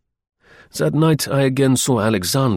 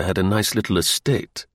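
ordinary delivery

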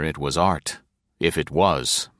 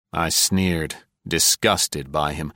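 plain reading voice

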